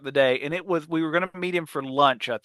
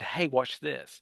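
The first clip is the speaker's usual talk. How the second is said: low, slow and hushed setup; quietly tip off a friend before a prank; softly drawn-out conspiratorial aside; sly aside; 3 semitones lower, 7 dB quieter, slower than usual. Retold or imitated. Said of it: "looked at me and said".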